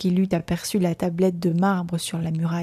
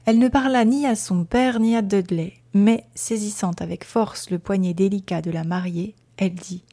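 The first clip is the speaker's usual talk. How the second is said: ordinary delivery, nothing marked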